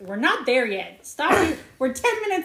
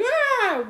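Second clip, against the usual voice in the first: a short mock scream, much higher; retold pained scream; 13 semitones higher, 4 dB louder than usual. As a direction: yelp it like Neo jolting awake. Retelling Neo waking up in pain.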